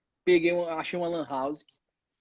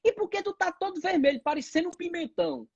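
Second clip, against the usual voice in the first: scolding grandma; 7 semitones higher than usual. Retold or imitated. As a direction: scold like a startled grandma, pitched up.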